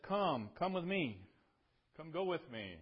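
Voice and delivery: loud, high-pitched, insistent beckoning; hearty loud invitation. Acted. David inviting Barzillai to join him, loud and urging.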